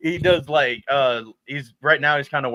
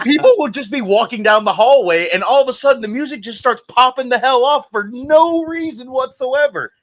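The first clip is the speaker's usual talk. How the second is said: excited quote; 9 semitones higher, 5 dB louder than usual. Retold or imitated. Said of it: "And he's like".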